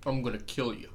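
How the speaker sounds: lightly put-on New York accent. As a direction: try out a New York tough-guy accent. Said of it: "like the New York".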